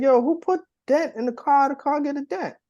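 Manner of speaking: high, loud scolding wife; shrill angry wife. Retold or imitated. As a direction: yell about the dented car. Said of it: "anything different".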